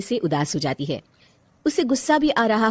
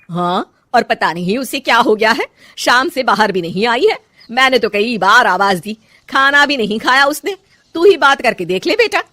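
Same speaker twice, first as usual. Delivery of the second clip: louder, complaining mother voice rising; worried mother-in-law; 3 semitones higher, 7 dB louder than usual. Acted. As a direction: tell the son everything. (शिकायत करती माँ)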